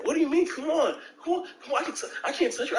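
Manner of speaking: high, begging mock voice of the boyfriend; whiny pleading. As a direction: play him as a desperate, whiny guy. Boyfriend begging girlfriend, retold.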